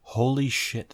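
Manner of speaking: ordinary delivery, nothing marked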